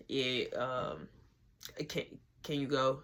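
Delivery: low, soft, stammering; retold sheepish, halting mumble; timid request. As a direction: act out reluctantly asking someone to leave. Timidly asks woman to leave.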